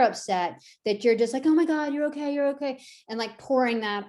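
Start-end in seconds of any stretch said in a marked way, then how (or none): 1.5-2.8 higher, fussing panic over the dog; freaked-out owner. Retold or imitated. Owner panicking over scared dog, mock frantic. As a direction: gush worried comfort at a scared pet. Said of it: "being freaked out yourself".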